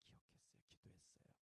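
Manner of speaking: breathy whispered 기억했어요, 기도했어요. Real, not acted. Pastor whispering to the returned sister, hushed. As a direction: whisper it tenderly.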